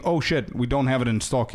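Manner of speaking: ordinary delivery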